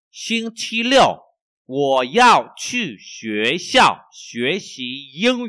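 slow, loud, carefully spaced Chinese for learners; slow model reading. Shown as a demonstration. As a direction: read each syllable slowly for the class.

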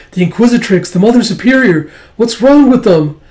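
high, rising panicked cry; acted alarm. Attendants seeing the Mother Superior stricken, high alarm.

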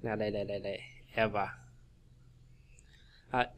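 normal talking voice

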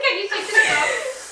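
high, slow, laughing words. Real, not acted. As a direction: squeal it out while laughing. Laughing through spicy challenge.

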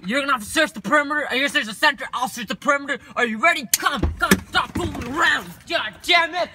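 urgent orders. Acted. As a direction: push the orders out loud and high.

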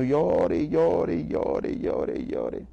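weeping words that fade away to a whisper; crying like a child. Retold or imitated. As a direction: let the crying die away into nothing. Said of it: "empecé a llorar como niño". (llorando como niño, apagándose hasta un susurro)

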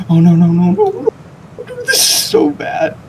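rising panic, high and loud; real panic as the crash comes; panicked. Real, not acted. Crashing vehicle in game panic.

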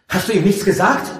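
high, loud reproach; indignant shouted question; agitated. A narrator's reading. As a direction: demand it angrily. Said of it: "sagte Eragon aufgebracht".

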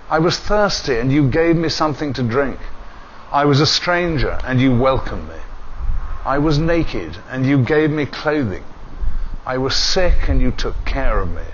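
usual speaking voice

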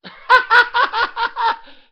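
big high burst of laughter; loud laugh. Real, not acted. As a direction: howl with laughter. Dad's loud laugh after joke.

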